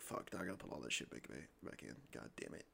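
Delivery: low muttered complaint under the breath; annoyed mutter, dropping away; muttered grumble. Retold or imitated. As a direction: grumble it quietly to yourself.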